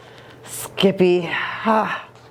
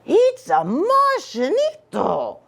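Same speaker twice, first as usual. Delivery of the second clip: high, slow amazed exclamation; 9 semitones higher, slower than usual. Acted. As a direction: marvel at the sight. Amazed discovery of alien.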